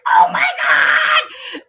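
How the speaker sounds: high slow shout of a laughing crowd. Retold or imitated. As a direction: yell it out high and long. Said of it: "They're like".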